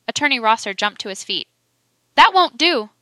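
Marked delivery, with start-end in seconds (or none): 2.1-2.9 loud, high-pitched exclamation; exclaimed objection. A narrator's reading. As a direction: burst out in sharp protest, voice raised. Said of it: "he exclaimed".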